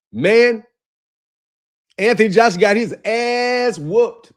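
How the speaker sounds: slow, drawn-out comic commentator impression; boxing commentator caricature. Streamer imitating a boxing comedian on Joshua's loss, drawn-out caricature. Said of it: "It's like a caricature".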